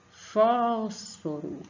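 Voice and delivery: "Fósforo" very slow, "Fós" stretched; stress on first syllable. Shown as a demonstration. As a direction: hold "Fós", then release the rest of "Fósforo". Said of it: "vocês põem mais força".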